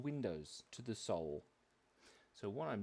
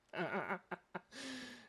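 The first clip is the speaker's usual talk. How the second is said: high giggle; 9 semitones higher, 4 dB quieter than usual. Real, not acted. Laughing after headache remark.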